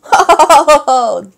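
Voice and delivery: loud, high belly laugh; big laugh. A narrator's reading. Boss pirate laughing at being tickled, loud. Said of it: "the boss pirate laughed".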